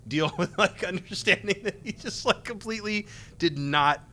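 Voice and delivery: talking through laughter; amused. Real, not acted. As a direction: laugh while trying to finish the story. Laughing at own story.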